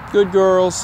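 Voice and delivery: pet-praise voice, lifted up; praising dogs. Real, not acted.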